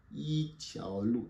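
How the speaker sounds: slow, syllable by syllable; slow model. Shown as a demonstration. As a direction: drill "yi tiao lu" for the class. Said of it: "Répétez après moi".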